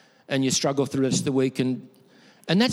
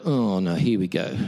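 weary dread; 7 semitones lower than usual. Retold or imitated. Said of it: "And I go".

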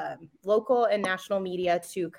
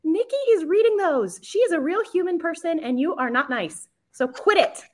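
raised-pitch emphatic telling-off; scolding online commenters; 8 semitones higher, 3 dB louder than usual. Retold or imitated. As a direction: scold them on a colleague's behalf, higher and firm. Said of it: "I'm like".

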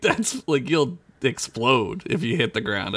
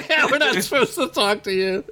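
laughing; 9 semitones higher than usual. Retold or imitated. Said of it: "they're like".

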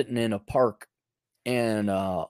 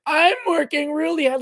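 mocking whine. Mocking adult children's complaint.

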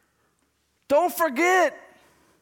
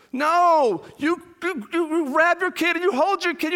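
usual speaking voice

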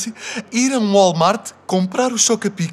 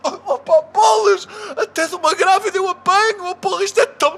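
high whiny lament; 6 semitones higher than usual. Acted. Husband whining after wife yells.

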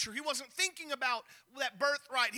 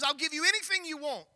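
desperate hungry plea; 4 semitones higher than usual. Retold or imitated. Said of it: "Esau is so hungry".

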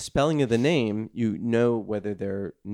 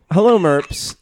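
pompous email voice; 5 semitones higher, 11 dB louder, slower than usual. Acted. Reading email in voice.

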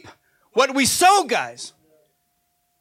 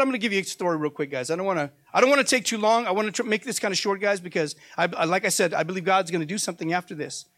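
quick, quieter aside; hurried aside; 4 semitones lower, 5 dB quieter, faster than usual. Real, not acted. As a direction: rush through it to keep it short. Rushed aside to the congregation.

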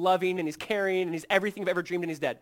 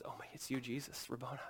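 whispered, much softer; awed whisper; 3 semitones lower, 12 dB quieter than usual. Acted. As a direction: breathe his name in awe. Mary recognises the risen Jesus.

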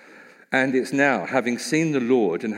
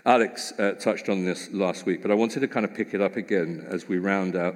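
ordinary delivery

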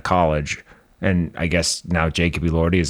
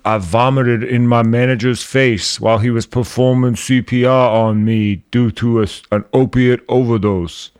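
higher, slurred put-on voice; slurry impression, pitched up and a bit loud; Angus Cloud; 4 semitones higher, 4 dB louder than usual. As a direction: slur all the words together. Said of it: "The guy who talks like this".

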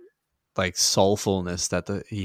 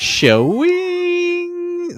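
very high, drawn-out "Showing"; piercing falsetto tease; squeaky leer; 8 dB louder than usual. Retold or imitated. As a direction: squeal it like a cartoon teen. Repeating the turtle sound effect.

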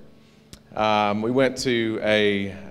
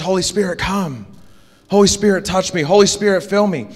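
higher-pitched, insistent re-enacted plea; naive child's prayer; 9 semitones higher, 4 dB louder than usual. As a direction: pray like an eager kid, lifting each "Holy Spirit". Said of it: "Like, I'm a kid. I don't know what to pray".

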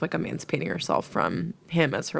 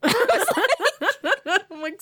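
laughing; 13 semitones higher than usual. Real, not acted. Laughs before the quote.

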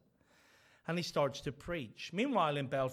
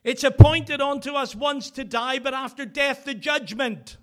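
very loud, high scripture shout; wife shouts scripture at her husband going out the door; 9 semitones higher, 14 dB louder than usual. Retold or imitated. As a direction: hurl the verse after him out the door. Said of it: "she shouted at him".

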